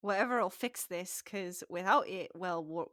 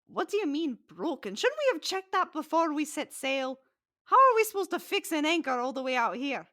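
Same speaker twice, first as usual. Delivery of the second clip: louder, higher scolding rant; exasperated captain; 7 semitones higher, 7 dB louder than usual. Acted. Captain scolding crewman over broken anchor, loud and exasperated. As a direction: tear into the crewman, rising anger. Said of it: "looks down at the guy like".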